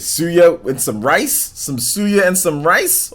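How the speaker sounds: slow, high drawn-out savoring. Real, not acted. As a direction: stretch it like tasting it. Savoring favorite dish.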